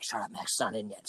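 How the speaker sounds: dad hushing. Retold or imitated. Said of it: "He's like".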